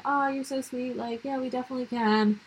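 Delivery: high, soft gushing reply; imitated sugary acceptance; sweet and agreeable. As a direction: coo it sweetly, lightly put on. Retelling sweet reply to gym guy's message.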